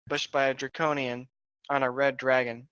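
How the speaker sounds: ordinary talk, nothing marked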